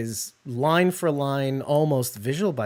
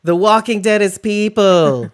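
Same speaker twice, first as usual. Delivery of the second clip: dramatic reveal; 7 semitones higher, 9 dB louder, slower than usual. Pretend. Soylent Green parody punchline.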